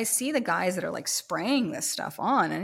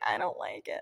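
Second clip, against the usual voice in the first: whispered complaint; 8 semitones lower, 3 dB quieter than usual. Retold or imitated. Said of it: "She's like".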